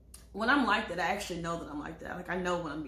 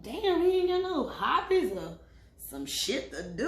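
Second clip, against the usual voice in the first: higher, louder exasperated gripe; annoyed; 5 semitones higher, 5 dB louder than usual. Retold or imitated. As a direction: complain he's got nothing better to do. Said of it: "I'm like".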